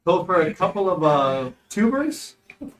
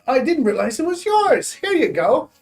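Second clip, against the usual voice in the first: high, loud innocent-sounding voice; sheepish character voice; 7 semitones higher, 4 dB louder than usual. Acted. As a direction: play it caught but innocent. Character hands back stolen book.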